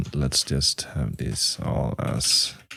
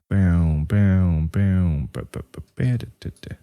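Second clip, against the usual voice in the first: vocal pitch bend; 2 semitones higher than usual. Shown as a demonstration. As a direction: sing the pitch-bend effect. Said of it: "do the automation".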